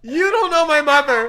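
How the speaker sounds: high, very loud outburst with laughter; real loud protest. Real, not acted. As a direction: shout it in laughing exasperation. Heated protest to amused friend.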